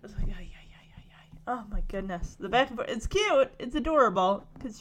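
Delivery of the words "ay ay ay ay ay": fading exasperated ay. Retold or imitated. Exasperated sigh at the mix-up.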